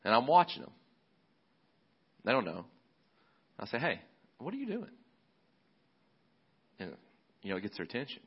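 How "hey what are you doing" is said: high, soft, curious parent call; light high call. Shown as a demonstration. Dad calling to his kids, high and soft, not mad. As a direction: lilt it up like catching a kid gently. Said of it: "I'm not yelling at them. I'm not mad at them".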